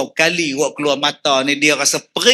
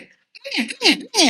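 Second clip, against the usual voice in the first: high, soft mock grandma; hushed granny scolding a child; grandma's voice; 14 semitones higher, 5 dB quieter, faster than usual. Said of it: "Cuba tengok opah dia". (suara opah yang berbisik tinggi)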